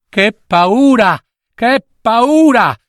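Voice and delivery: high, drawn-out exclamation; frightened old mistress. A narrator's reading. Old mistress greets the returning pair, frightened. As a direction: exclaim slowly and high, still shaken. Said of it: "disse la vecchia padrona".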